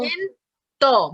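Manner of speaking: slow "Trescientos", last syllable set apart; split into syllables. Shown as a demonstration. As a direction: break up "Trescientos" before its final beat. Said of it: "que repita esta última".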